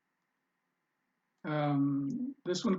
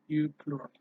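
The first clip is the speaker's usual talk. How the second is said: normal talking voice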